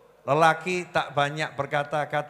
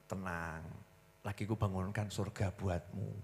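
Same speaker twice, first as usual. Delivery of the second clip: soft, low husband soothing his wife; calm reassurance; 8 semitones lower, 14 dB quieter than usual. Retold or imitated. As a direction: hush her gently, dropping the voice low. (suami menenangkan istri dengan suara pelan dan rendah)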